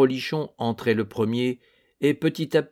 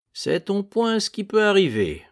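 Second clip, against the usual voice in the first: plain reading voice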